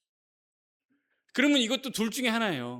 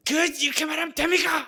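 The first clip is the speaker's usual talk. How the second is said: high, raised-voice protest, acted out; quarrelsome shouting; 9 semitones higher, 7 dB louder than usual. Offended churchgoer confronting someone, loudly quarrelsome. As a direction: burst out as if picking a fight. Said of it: "큰 소리 치고".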